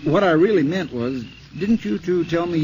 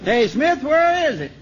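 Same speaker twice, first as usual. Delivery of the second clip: high, louder call across the distance; 10 semitones higher, 3 dB louder than usual. Acted. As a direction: hail him from far away. Calling to ranger in gully.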